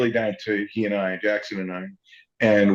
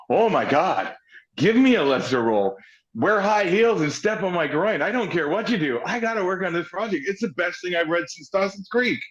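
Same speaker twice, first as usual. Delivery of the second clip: high-pitched, over-the-top begging; eager pleading; 8 semitones higher than usual. Retold or imitated. Actor begging the casting director for a lesser role, high and eager. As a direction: retell a desperate pitch with rising excitement.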